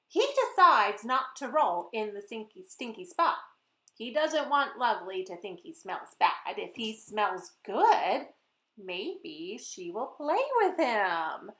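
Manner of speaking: put-on lively, sing-song narration for kids; storybook read-aloud. A narrator's reading. Author reading her book to children, lively and playful. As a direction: read it like story time for little ones.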